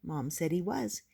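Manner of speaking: ordinary delivery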